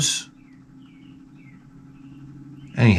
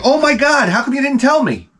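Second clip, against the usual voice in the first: high-pitched mock dismay; flustered woman impersonation. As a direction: imitate an alarmed woman, voice shooting way up. Imitates the flustered coffee woman.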